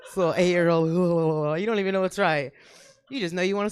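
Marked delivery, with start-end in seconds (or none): none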